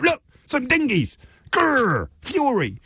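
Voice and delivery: high-pitched, hammed-up alarm and fury; mock outrage. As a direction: squeal it as sarcastic fake outrage. Mocks a staged outrage as distraction.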